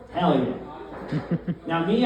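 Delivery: usual speaking voice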